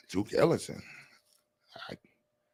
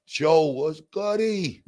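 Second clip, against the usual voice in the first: high, slow stretched-out what's goody; drawn-out greeting; 8 semitones higher, much slower than usual. Acted. Streamer greeting a viewer in a high, slow drawl. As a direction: drag the greeting out, sing-song.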